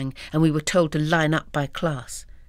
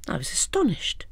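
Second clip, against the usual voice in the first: astonished whisper; 3 semitones higher, 4 dB quieter than usual. A narrator's reading.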